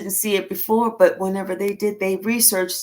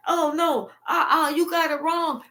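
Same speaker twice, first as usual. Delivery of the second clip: mock whimpering plea; 7 semitones higher than usual. Liar pleading when caught.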